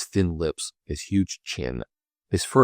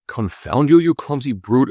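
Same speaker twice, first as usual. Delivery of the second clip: sharp, loud curse at the stranger; angry exclamation; 7 semitones higher, 5 dB louder, slower than usual. A narrator's reading. Captain cursing the clumsy stranger who bumped him, angry. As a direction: snap it out, startled and cross. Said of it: "exclaimed the captain".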